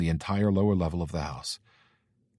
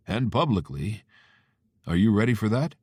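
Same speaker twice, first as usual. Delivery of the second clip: concerned dad; 3 semitones higher, 4 dB louder than usual. Acted. Father voiced.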